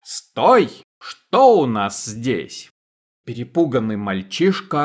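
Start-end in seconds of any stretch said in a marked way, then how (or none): 0.0-2.7 sharp, loud shout that slows into a menacing question; a highwayman ambushing a boy. Acted. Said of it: "выпрыгнул на дорогу с криком". (резкий окрик, затем медленный угрожающий вопрос)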